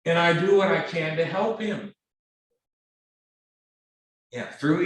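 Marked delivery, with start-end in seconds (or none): none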